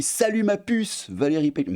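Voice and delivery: exaggerated high-pitched flirty hello; cheeky mock greeting. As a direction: call it out high and teasing. Acts out Eden's cheeky tweet.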